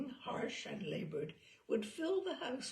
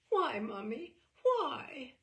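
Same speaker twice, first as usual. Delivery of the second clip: put-on higher, louder, a small girl's pleading why; plaintive child's question; 6 semitones higher, 6 dB louder, slower than usual. Hurt daughter asks her mother why the boy prefers another girl, pleading. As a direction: ask it like a hurt little girl begging for an answer.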